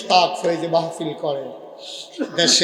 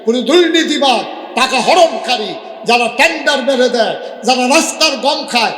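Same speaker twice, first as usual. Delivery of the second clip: high, loud rapid-fire denunciation; fiery preaching; 7 semitones higher, 6 dB louder than usual. Acted. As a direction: preach at full force, climbing to a high cry. (জোর গলায় জ্বালাময়ী ওয়াজ)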